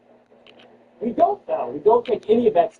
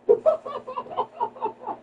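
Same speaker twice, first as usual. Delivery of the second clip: high-pitched burst of laughter; 4 dB quieter than usual. Real, not acted. Host cracking up at own bad ideas, high laugh. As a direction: laugh in a high, sustained run.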